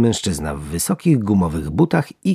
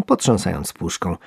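plain reading voice

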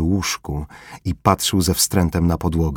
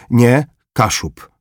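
slow, higher, insistent correction; with emphasis; 5 semitones higher, 5 dB louder, slower than usual. Acted. Formela insisting to the corporal, slow emphatic correction. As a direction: Kaszub said slowly and firmly. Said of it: "powtórzył z naciskiem".

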